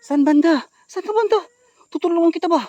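high, loud searching shout; calling out. Real, not acted. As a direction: call into the distance, offering help. Searching, calling to hidden woman. (pasigaw na paghahanap)

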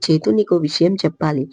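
ordinary delivery, nothing marked

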